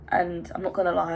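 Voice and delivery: usual speaking voice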